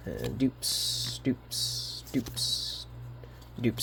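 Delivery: whispering under breath; whispered mutter. Real, not acted. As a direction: mutter it to yourself. Muttering over duplicate cards.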